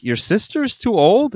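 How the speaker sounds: high, drawn-out baffled echo; incredulous question. Retold or imitated. Guest questioning the host's wife, incredulous. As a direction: question it back in retold disbelief.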